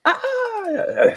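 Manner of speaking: sudden high yelp imitating the dog; a sharp, very high cry of pain; mock yelp of a hurt dog. Dog tears ACL, mimicked yelp.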